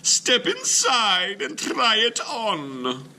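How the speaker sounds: very high, slow, loud sing-song; sweet old lady. Acted. Missus Wilkins offers Mister Klug a treat, high and sing-song. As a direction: present it like a delighted granny.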